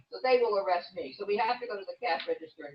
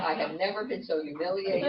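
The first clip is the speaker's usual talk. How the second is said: ordinary talk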